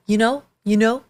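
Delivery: a light Brooklyn lilt on you know, lightly imitated; Brooklyn accent. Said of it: "in her Brooklyn accent".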